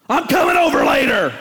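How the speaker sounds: mock all caps shout. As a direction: read it like it's yelling in caps. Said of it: "you think he's screaming at you".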